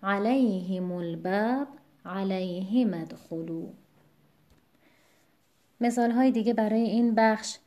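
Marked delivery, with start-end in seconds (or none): 0.0-3.7 علیهم الباب stressed on ل, علیهم ادخلوا stressed on ه, slowly; slow side-by-side demo; contrasting stress. Shown as a demonstration. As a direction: show the shifting stress of علیهم in both phrases. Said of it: "پشت سر هم بخونیم".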